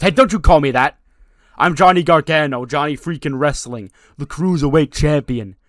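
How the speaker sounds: loud, high arrogant champion; indignant boast. Retold or imitated. Johnny declaring his title to Danhausen, loud and arrogant. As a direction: puff up as the champion.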